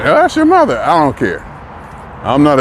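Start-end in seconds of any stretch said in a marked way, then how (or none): 0.0-0.8 high-pitched mock objection; mimicked protest. Relatives object to his lack of feeling, mock high-pitched protest.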